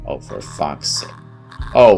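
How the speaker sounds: ordinary talk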